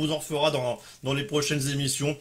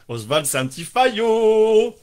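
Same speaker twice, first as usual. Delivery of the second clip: slow, drawn-out playful jab, higher and louder; mock teasing; 6 semitones higher, 6 dB louder, slower than usual. Host teases regular winner Oswald, mock taunting. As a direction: tease him like a schoolyard taunt.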